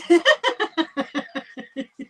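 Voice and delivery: bursts out laughing; a real laugh at a joke. Real, not acted.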